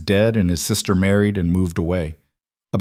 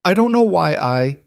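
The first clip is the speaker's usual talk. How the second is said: flustered; 9 semitones higher than usual. Acted. Flustered after throwing snowball.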